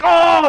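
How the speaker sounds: high, loud yelp of pain; hammed-up cry of pain; Venusaur's pained cry. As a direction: cry out as the hit lands. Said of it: "it would be like".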